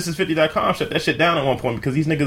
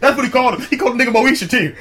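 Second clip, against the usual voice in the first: high, laughing retelling; real laughing disbelief; 11 semitones higher, 4 dB louder, faster than usual. Real, not acted. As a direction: push the words out high while cracking up. Cracking up at the nickname.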